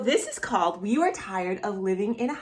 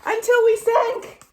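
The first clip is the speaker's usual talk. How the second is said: much higher, louder storybook alarm; mock shock; 5 dB louder than usual. Reading the fall from the tree, hammed-up shock. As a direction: play the twist big for the kids.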